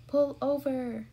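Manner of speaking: loud, drawn-out police order; bullhorn shout. A narrator's reading. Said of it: "He shouted into his horn, pull, bullhorn".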